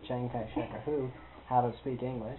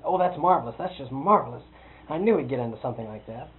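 delighted exclamation; 2 semitones higher, 10 dB louder than usual. Retold or imitated. The visitor gushes about Guy, loud and delighted. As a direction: gush over the news like an old friend.

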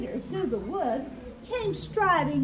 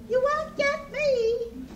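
high, slow little pig voice; defiant piggy cry; 10 semitones higher, slower than usual. A narrator's reading. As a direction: taunt the wolf in a stretched high voice. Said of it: "Piggy cried".

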